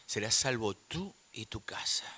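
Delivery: low, half-whispered verse; hushed promise. Real, not acted. Preacher repeats the promise to the church, hushed. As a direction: let the promise sink into a whisper.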